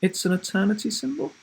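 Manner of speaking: put-on a boy explaining, a little rushed; boy's quick answer.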